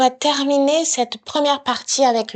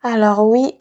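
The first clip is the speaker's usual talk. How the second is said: normal talking voice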